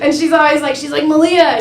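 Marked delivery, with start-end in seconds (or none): 1.1-1.6 lightly voiced mom calling out; a hint of mom's Hawaiian accent, pitched up. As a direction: call the name as mom might. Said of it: "She has an accent".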